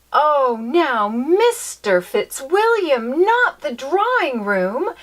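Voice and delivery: slow, high, loud protest; emphatic. Acted. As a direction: lean hard on every word to forbid it. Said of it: "she said emphatically".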